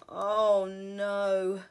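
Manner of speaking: loud, despairing groan; weary bear. Acted. Mister Bear's weary complaint.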